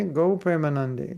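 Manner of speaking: ordinary talk, nothing marked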